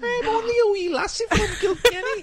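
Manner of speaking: very high, put-on little-lassie voice; hammed-up pitiful falsetto; wee Irish lassie. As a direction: squeal it like a tiny Irish girl. Puts on a stock character catchphrase.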